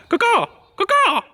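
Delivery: high cawing crow call. Retold or imitated. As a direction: caw like a crow. Imitates a bird calling out.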